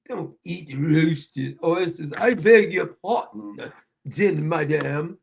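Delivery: slow, put-on British accent; hammed-up British hauteur; mock British. Mocking Clever over roasted oysters, mock British. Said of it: "She sounded so British".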